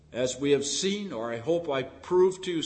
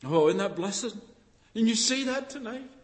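ordinary talk, nothing marked

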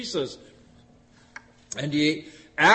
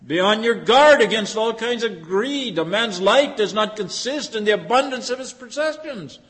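stern warning; 5 semitones higher, 6 dB louder than usual. Retold or imitated. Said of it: "He says".

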